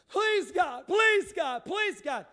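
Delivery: loud high pleading to God; begging cry. Retold or imitated. Penitent begging God at the altar, loud desperate pleading. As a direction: cry out please, building and falling. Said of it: "They start begging".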